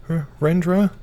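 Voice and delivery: high trembling frail mother voice; a fragile mum, barely holding together; quivering weak mother. Acted. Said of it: "Mum's quivering and weak voice".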